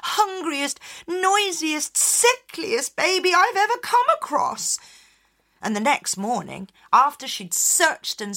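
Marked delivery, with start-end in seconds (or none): 0.0-4.8 high, louder fed-up mother; exasperated mother. Acted. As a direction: despair at the noisy baby. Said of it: "said Orla".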